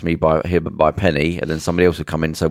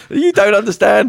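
high pleading refugee; 5 dB louder than usual. Retold or imitated. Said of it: "and then it".

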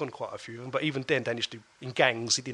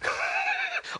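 breathless laugh. Real, not acted. Laughing at his own folly.

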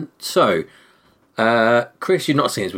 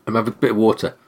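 normal talking voice

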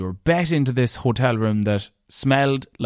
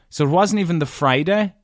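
normal talking voice

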